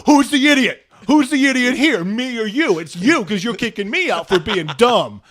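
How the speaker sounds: high and loud, jabbing each question; furious retold argument with the manager; angry yelling. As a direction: shout it in his face. Arguing with the manager.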